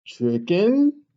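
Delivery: ordinary delivery, nothing marked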